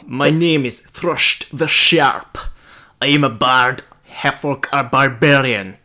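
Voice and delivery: put-on slow, lightly done Sean Connery accent. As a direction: slowly try a Scottish Sean Connery accent. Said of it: "I'm trying my Sean Connery accent".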